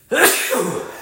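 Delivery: hard cough; coughing fit. Real, not acted.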